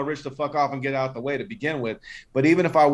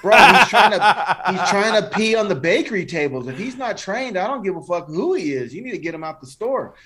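retold confrontation; 6 semitones higher, 5 dB louder than usual. Confronting customer with pit bull.

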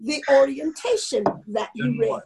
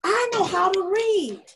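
put-on higher child voice, indignant; little boy voice; 6 semitones higher than usual. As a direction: play the little boy proving himself. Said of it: "And he says".

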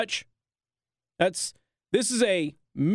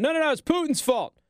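much higher, silly put-on whine; mocking impression; 9 semitones higher than usual. Host ridicules Biden blaming Putin, high mocking whine. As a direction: lampoon him passing the blame.

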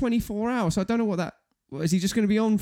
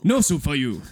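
Soup Nazi; 4 semitones lower than usual. Acted. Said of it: "the soup Nazi from Seinfeld".

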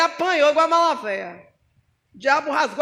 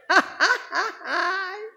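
riso breve; 3 semitones higher than usual. Real, not acted.

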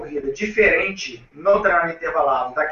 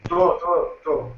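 choppy breaking-up audio; slower than usual. Real, not acted. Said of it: "É, picotou".

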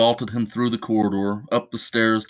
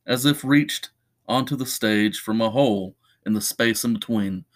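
ordinary delivery

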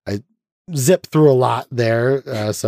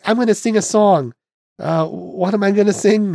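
lightly voiced Lena, pitch much higher; a hint of the starlet, raised high; Lena's voice; 10 semitones higher than usual. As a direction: podcaster giving Lena her high voice again. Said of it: "weirded out by her voice".

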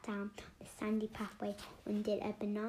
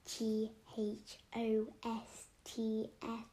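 spelled out slowly; much slower than usual. Acted. Spelling word out.

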